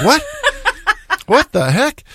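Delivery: high laughing what the heck; laughing surprise. Retold or imitated. Learning the stranger is a CEO, laughing in surprise. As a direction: burst out amazed and laughing.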